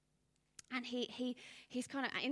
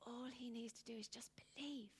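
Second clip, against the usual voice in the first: an even quieter whispered plea; pleading softly, almost unvoiced; softer whisper; 7 dB quieter than usual. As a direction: let it sink to a bare whisper. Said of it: "And they're like".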